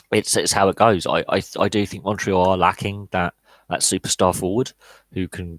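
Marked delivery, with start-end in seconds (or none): none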